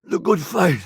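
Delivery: coughing, struggling to get words out; wounded last words. Acted. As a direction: force it out in broken pieces, fading at the end.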